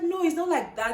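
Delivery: high, loud retold objection; urgent protest. Student protests to examiner doubting her, urgently. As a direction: protest insistently, imitating your upset self.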